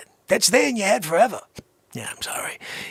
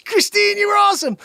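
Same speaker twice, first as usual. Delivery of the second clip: excited shout; 11 semitones higher, faster than usual. Real, not acted. Praising Christine on stream.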